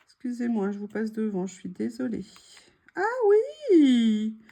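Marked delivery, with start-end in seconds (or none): 2.9-4.4 high, loud cry of delight; excited ah oui. Real, not acted. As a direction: exclaim with real excitement. Excited at discovery.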